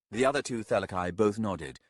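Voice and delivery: ordinary delivery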